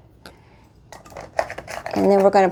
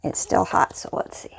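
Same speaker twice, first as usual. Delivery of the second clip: whispering; 3 semitones lower, 8 dB quieter than usual. Real, not acted. Testing hot food.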